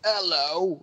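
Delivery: mock a bad Cockney greeting; horrible British accent. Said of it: "develops a horrible British accent".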